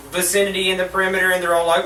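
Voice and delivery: ordinary delivery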